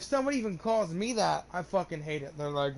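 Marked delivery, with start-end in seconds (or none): none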